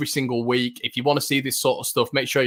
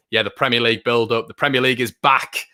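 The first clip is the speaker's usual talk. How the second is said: normal talking voice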